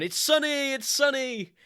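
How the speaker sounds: high, loud sing-song It's sunny; giddy high cheer about the sun; giddy joking. Retold or imitated. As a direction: squeal it out like a happy kid. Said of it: "I'm laughing, I'm joking".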